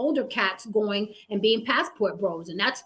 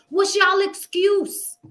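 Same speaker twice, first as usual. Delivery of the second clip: higher, slow and drawn-out demand; slow challenge; 8 semitones higher, 4 dB louder, much slower than usual. Real, not acted. Host challenging the masculine panelists, slow and pointed. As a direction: drag it out, daring them to answer.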